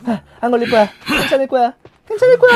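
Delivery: high, loud sing-song mock reassurance; mock their naive hope, laughing; mocking hopeful survivor. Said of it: "희망회로 돌리면서".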